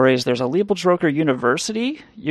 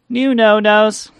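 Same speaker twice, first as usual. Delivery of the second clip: announced bit title; 7 semitones higher, 4 dB louder, slower than usual. Acted. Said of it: "Here's my first new no".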